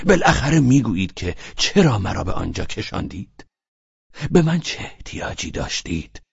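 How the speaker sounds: usual speaking voice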